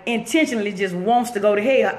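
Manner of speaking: usual speaking voice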